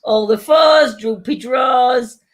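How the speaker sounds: slow, shouted bingo call; bingo caller. Pretend. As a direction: belt it out like a bingo hall. Calling bingo numbers with rhymes.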